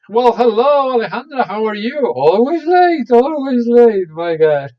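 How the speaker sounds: high, loud greeting with playful scolding; loud teasing welcome. Real, not acted. Teacher greeting always-late student, loud playful scolding. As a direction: call out in mock exasperation.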